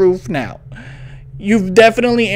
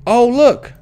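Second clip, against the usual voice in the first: normal talking voice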